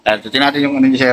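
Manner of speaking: usual speaking voice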